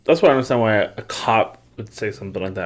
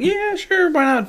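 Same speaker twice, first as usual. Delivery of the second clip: mock eager yes; slower than usual. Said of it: "And I was like".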